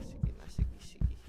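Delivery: soft whispered urging; whisper, trying not to be heard; whispering while hiding. Real, not acted. Sneaking past monster.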